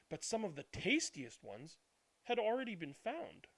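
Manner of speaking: lively, expressive picture-book reading; storybook reading. Acted. Reading a picture book aloud to young viewers, expressive storytelling. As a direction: read it like a bedtime story for small children.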